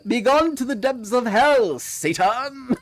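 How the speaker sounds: hammed-up exorcist, high and loud; mock exorcist. Casting the cucumber out, hammed-up exorcist cry. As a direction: banish Satan with theatrical fury.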